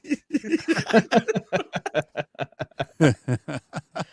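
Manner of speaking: long, trailing chuckle; amused laughter. Real, not acted. Reader cracking up after the Gambit line, amused laughter. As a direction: laugh after your own bit.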